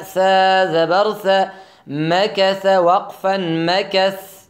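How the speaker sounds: slow, loud, syllable by syllable; slow spelling drill. Shown as a demonstration. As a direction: spell each letter out slowly, like a Quran teacher. (تلفظ آهسته و بلند حرف به حرف)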